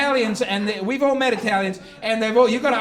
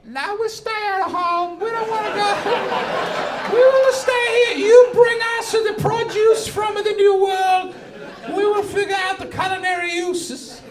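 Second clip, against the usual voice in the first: mock Italian; 9 semitones higher than usual. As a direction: play a boisterous Italian refusing the voyage. Italians refusing to explore, voiced.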